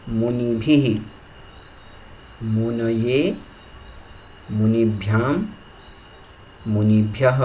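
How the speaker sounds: each case form spaced out by pauses, ending on a slight rise; recitation drill. Shown as a demonstration. Teacher demonstrating muni forms to listening students, careful chant. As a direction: chant the declension slowly and clearly. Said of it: "एक बार फिर सुने आप सभी ध्यान से".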